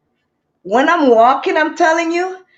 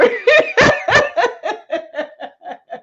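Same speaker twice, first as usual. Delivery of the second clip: high shouted laugh; 10 semitones higher than usual. Real, not acted. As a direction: burst out laughing. Laughing loudly.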